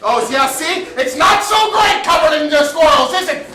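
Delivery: hysterical. Acted. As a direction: push it to a frantic edge.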